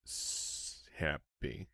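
faint, low, hesitant happy; barely commit to it; low, hushed, reluctant; grudging happy. Real, not acted. Reluctant vote on Tua.

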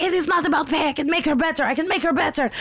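high, insistent imitation; mock insistent nurse. Imitating the nurse insisting to Chris, high mock insistence. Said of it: "She's like".

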